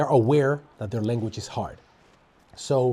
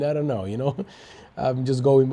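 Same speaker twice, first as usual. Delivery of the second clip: usual speaking voice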